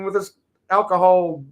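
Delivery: ordinary talk, nothing marked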